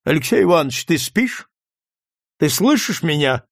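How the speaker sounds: sudden loud call. A narrator's reading. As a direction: call to rouse a dozing companion. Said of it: "неожиданно громко окликнул Рогачёва".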